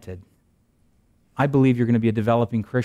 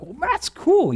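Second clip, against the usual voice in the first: excited; slower than usual. Retold or imitated. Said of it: "And I'm like".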